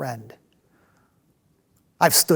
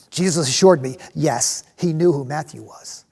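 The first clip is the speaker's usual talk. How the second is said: normal talking voice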